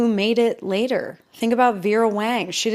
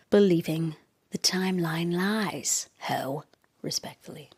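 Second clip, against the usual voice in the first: breathy comic whisper; 2 semitones lower, 3 dB quieter than usual. Acted. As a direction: whisper it like a secret. Aside whispered for comic effect.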